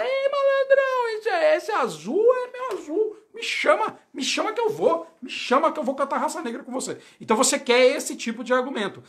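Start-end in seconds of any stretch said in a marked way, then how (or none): 0.0-7.0 high, stretched, over-the-top happy customer; won-over client. Retold or imitated. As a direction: play a delighted customer hooked on the idea. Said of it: "A pessoa fala".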